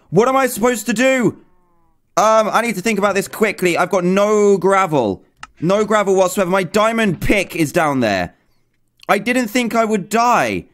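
high-pitched, stressed wail; panicked dismay. Real, not acted. Gamer realising all the items are lost, panicked dismay.

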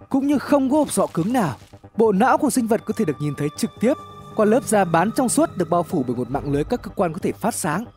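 ordinary delivery, nothing marked

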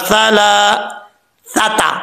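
careful recitation; no whistle on sa. Shown as a demonstration. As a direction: draw out सलासता, no whistling s. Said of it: "सीटी नहीं आनी".

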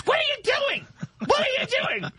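put-on high shrieking yell as the girlfriend; imagined girlfriend yelling.

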